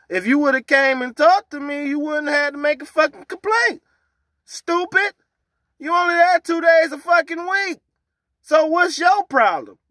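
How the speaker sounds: loud, high, slowing scorn; angry rant. Real, not acted. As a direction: berate her with rising scorn. Ranting at complainer.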